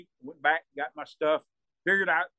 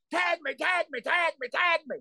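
high urgent repeated shout; frantic shouted plea; 11 semitones higher than usual. Retold or imitated. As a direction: beg your partner to tag you, fast. Begging partner to tag.

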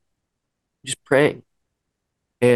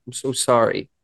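ordinary talk, nothing marked

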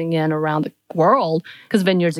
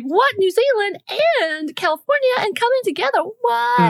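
much higher, incredulous "what"; high amazed disbelief. Retold or imitated. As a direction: squeal it in stunned disbelief. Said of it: "I'm like".

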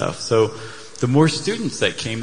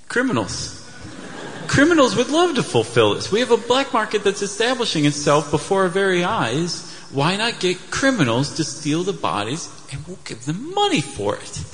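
higher, laughing scheming official; 6 semitones higher than usual. Retold or imitated. Officials voiced scheming about hiring criminals, higher and laughing. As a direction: pitch the crooked idea with glee. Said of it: "And they'd say".

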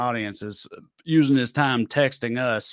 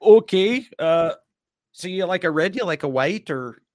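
mock Midwestern hospitality; Midwestern accent; 2 semitones higher than usual. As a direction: offer wine as a polite Midwesterner. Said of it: "Midwestern accent discussing wine".

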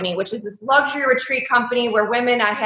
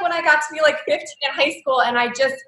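normal talking voice

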